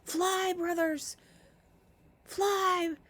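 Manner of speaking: high panicked shrieks; shrieking. Acted. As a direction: scream it out as they flee in terror. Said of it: "shrieking as they fled".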